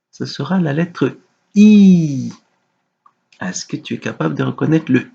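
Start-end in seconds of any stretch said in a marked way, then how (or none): 1.5-2.3 I held long and loud, gliding down; drawn-out letter. Shown as a demonstration. Teacher dictating a letter to a young pupil, drawn-out I. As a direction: stretch out "I" so the child hears it.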